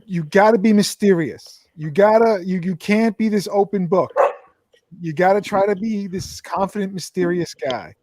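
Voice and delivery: normal talking voice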